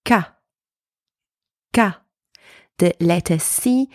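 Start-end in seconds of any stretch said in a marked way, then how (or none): 0.0-2.0 "ka" with a hard K and open ah; "ka" modelled as a separate syllable; isolated syllable demo. Shown as a demonstration. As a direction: say "ka" alone, pause, repeat it. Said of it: "pronounced like the letter K in English".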